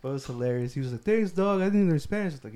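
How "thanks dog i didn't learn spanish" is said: put-on Texas Mexican accent. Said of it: "this guy has a heavy accent".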